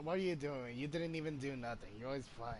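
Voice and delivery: normal talking voice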